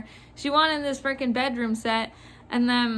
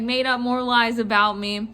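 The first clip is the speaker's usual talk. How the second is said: usual speaking voice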